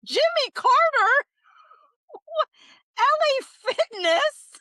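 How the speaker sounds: high, loud, incredulous; each name a new shock; stunned. Real, not acted. Streamer reading absurd names, loud and high in shock.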